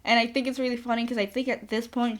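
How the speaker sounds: usual speaking voice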